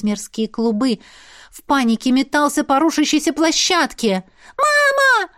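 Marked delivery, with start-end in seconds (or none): 4.6-5.3 put-on child screaming. A narrator's reading. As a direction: scream like a panicked little boy. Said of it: "кричал он, срывая голос". (высокий детский крик)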